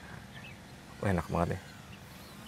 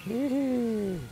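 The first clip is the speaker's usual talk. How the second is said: giggling; 6 dB quieter than usual. Real, not acted. Giggling at the flight.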